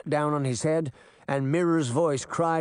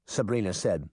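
plain reading voice

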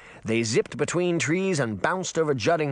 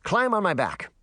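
put-on insistent boy; 7 semitones higher than usual. A narrator's reading. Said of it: "Puck insisted".

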